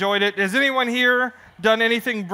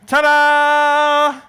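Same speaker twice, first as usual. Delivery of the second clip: higher, loud fanfare; showman ta-da; 6 semitones higher, 7 dB louder than usual. Acted. Showman unveiling the finished stunt, loud fanfare. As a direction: belt it like a circus ringmaster.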